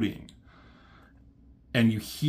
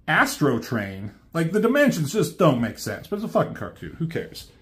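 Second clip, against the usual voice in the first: usual speaking voice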